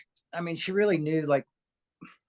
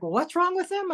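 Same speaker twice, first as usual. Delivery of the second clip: mom's voice; 12 semitones higher than usual. Retold or imitated. Mom voiced in story.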